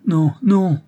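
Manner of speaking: high, loud protest; repeated cry. A narrator's reading. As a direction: cry out in urgent denial. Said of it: "s'écria plusieurs fois Angélique". (cri de protestation aigu)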